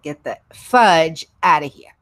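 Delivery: "fudge" hit loud and drawn out; emphatic rebuke. Retold or imitated. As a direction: tell them off, leaning hard on "fudge". Repeats her rebuke to a stalker.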